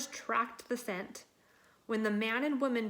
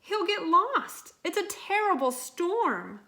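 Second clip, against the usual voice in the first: high, louder worried cry; high anxious pleading; 8 semitones higher, 6 dB louder than usual. Acted. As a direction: fret aloud about the lost dog. Worried owner voiced in story.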